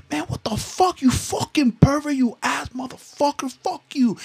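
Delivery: high, mock insulting; high mock outrage. Cursing at the pervert in a high mock voice. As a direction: shriek insults in a high put-on voice. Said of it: "I'm like".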